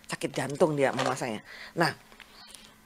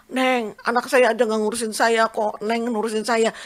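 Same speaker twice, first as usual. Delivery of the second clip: higher, louder retold outpouring; moved patient; 6 semitones higher, 5 dB louder than usual. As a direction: imitate an overwhelmed patient, pitch held high. Patient hugs her, overcome with gratitude.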